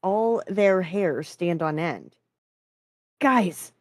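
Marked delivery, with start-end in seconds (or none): 3.2-3.7 high, urgent call to the group; shout. A narrator's reading. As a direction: call out to get their attention. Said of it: "Jason shouted".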